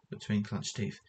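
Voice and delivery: plain reading voice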